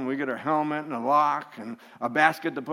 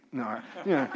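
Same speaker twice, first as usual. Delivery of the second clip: ordinary talk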